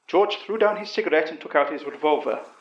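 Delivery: plain reading voice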